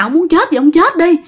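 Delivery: high bitter outburst; furious wife. Acted. Wife tells the suicidal husband to go ahead, high and bitter. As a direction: fling it at him. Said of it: "Bà nói rằng".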